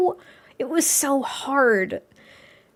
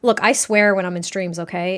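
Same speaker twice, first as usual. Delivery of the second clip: normal talking voice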